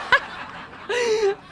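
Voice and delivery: high, real peal of laughing; peal of laughter. Real, not acted. Actress declining a fan's date, high peal of laughter. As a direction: burst out laughing helplessly.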